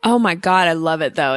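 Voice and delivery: ordinary delivery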